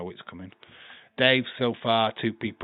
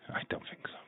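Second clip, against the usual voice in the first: whispered doubt; 12 dB quieter, faster than usual. Real, not acted. Doubting a debut prediction.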